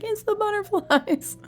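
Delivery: high, slow awed delight; touched. Retold or imitated. Recalling crying over the game, high slow wonder. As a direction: marvel at the butterflies. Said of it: "and I was like".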